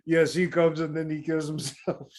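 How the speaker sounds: light mock French accent; joking French accent from a podcast host. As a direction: lay a playful French accent on it. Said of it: "that's my great French accent".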